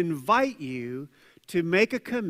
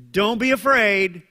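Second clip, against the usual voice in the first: louder, firm and sure command not to fear; firm reassurance; 5 semitones higher, 8 dB louder than usual. Acted. Jesus to Jairus against bad news, firm and loud. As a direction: plant it firmly so fear has no room.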